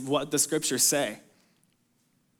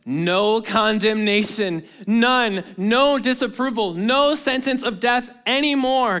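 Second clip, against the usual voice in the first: raised, triumphant preaching of the verse; emphatic proclamation; 6 semitones higher, 4 dB louder than usual. Acted. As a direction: announce it like a verdict overturned. Preacher proclaiming Paul's words.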